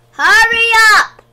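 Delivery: very loud, high, impatient yell; cross shout. A narrator's reading. Man hurrying children into gas masks, cross shout. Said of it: "shouted the man crossly".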